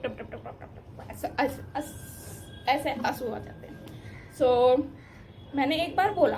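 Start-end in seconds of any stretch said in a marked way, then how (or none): 0.0-2.9 mock teardrops. Shown as a demonstration. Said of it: "ऐसे, ऐसे टप".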